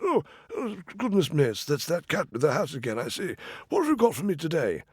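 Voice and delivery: high, flustered royal voice; a delighted king caught off guard; fussy king. Acted. King greeting the cat with the hat again, high and flustered.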